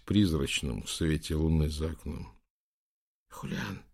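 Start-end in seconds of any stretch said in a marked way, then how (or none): 3.3-3.8 soft, hushed calling of a name; whispered. A narrator's reading. Bishop to the prince by the king's bed, whispered. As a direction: breathe the name out quietly. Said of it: "прошептал он".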